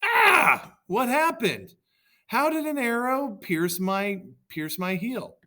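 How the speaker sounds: very high, loud shocked yells of pain. A narrator's reading. Achilles struck in the heel, yelling in high shocked pain. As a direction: cry out as the arrow strikes. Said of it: "Achilles yells".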